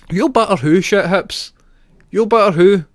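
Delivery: high, loud mock outrage; mock aggressive retort. Streamer firing back at a chatter's threat, mock outrage. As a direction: bark it back at him in fake fury.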